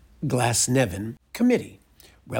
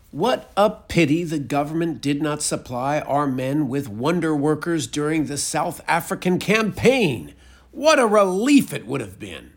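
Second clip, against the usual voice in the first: a lightly voiced gushing letter writer, louder and higher; voiced testimonial; 4 semitones higher, 7 dB louder than usual. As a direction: read the beggar's praise with mild fervour. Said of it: "concluding testimonial".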